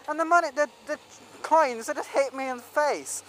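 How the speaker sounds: ordinary talk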